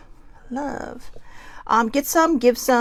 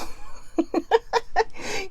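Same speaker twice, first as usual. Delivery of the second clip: giggle; 10 semitones higher, 3 dB quieter than usual. Real, not acted. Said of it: "I laugh at my own jokes".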